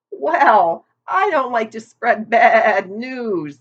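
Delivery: put-on a gossipy old sheep leaning in. A narrator's reading.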